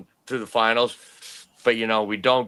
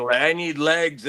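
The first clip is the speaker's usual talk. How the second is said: retold scream; 6 semitones higher, 3 dB louder than usual. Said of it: "So I'm screaming".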